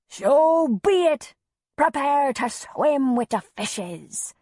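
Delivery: slow, high, loud hammed-up pirate roar; pirate bellow. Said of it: "Antonia bellowed".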